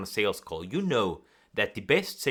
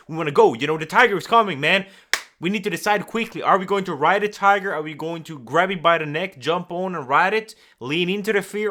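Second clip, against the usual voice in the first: higher and louder, rushed like a tiger is coming; urgent panic; 5 semitones higher, 6 dB louder than usual. Shown as a demonstration. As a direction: sell the panic of fleeing danger.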